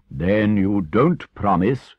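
slow, loud challenge; scandalized, weighty questioning; stern Badger.

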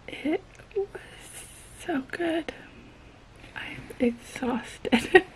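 soft tired whisper. Real, not acted. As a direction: whisper it, worn out. Late at night, exhausted.

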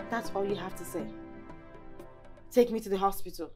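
very soft, low reply; hurt disbelief. Acted. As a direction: echo him quietly, deeply let down. Woman disappointed by his offer.

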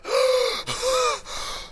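long, high demo gasps; out-of-breath gasps. Shown as a demonstration. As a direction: gasp as if out of breath. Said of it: "Which one was more out of breath?".

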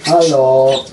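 normal talking voice

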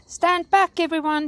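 high, loud warning to a crowd; warning call. A narrator's reading. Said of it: "she warned".

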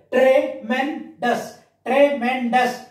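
TREMENDOUS as "tre, men, dous" with gaps; three separate parts. Shown as a demonstration. Teacher showing pupils three parts, syllable by syllable. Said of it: "Three parts".

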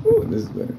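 sudden high ooh, then back down; pleased squeal. Real, not acted. A tenant finding a clearer document, squealing with delight. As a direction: squeak with delight at the better shot.

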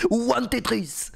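put-on high, drawn-out French-accented English; exaggerated French accent on English words; thick French accent. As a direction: imitate a cheesy French accent, high and slow. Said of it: "l'accent fromage".

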